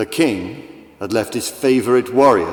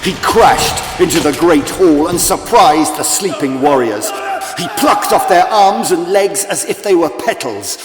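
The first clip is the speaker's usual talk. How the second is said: dramatic shout; 7 semitones higher, 7 dB louder than usual. A narrator's reading. Violent attack narrated at climax.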